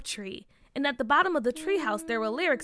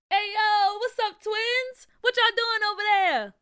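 hollering; 8 semitones higher, 4 dB louder than usual. A narrator's reading. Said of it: "Nathaniel hollered".

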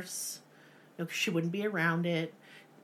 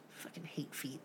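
muttered disgust; 5 semitones lower, 18 dB quieter than usual. Real, not acted.

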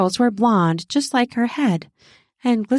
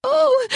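high startled squeak; short, high-pitched squeaked cry. Acted. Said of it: "She squeaked".

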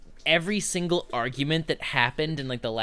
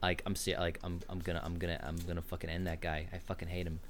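reality show tough guy; 9 semitones lower, 5 dB louder, faster than usual. Retold or imitated. Reality show contestant vowing to fight someone, low mock mutter. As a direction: grumble it low like a sulking reality star. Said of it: "It's like".

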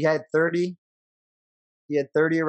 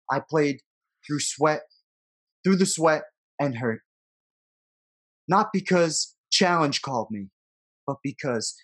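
dramatic poem reading. Acted. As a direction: read it like a farewell letter. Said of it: "You wanna read the poem for us".

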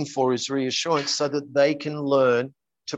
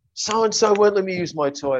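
higher voice imitating an upset child; upset little girl; 6 semitones higher, 3 dB louder than usual. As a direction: lightly mimic a child's tearful complaint. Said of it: "and she's crying".

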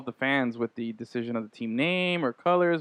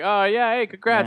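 mock celebrity thank-you; 8 semitones higher, 4 dB louder, slower than usual.

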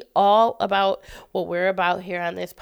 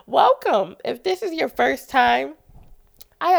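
congested, blocked-nose voice; stuffy nose; 5 semitones higher, 6 dB louder than usual. Real, not acted. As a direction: talk through a head cold. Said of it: "I don't sound so stuffy".

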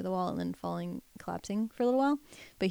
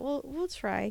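ordinary talk, nothing marked

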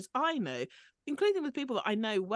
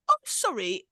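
exaggerated British apology, louder; put-on British accent; 3 semitones higher, 7 dB louder than usual. Podcaster mimicking a British castmate declining an invitation, put-on British accent. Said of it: "I'm doing that accent".

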